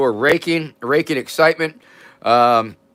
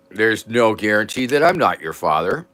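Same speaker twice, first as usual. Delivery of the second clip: usual speaking voice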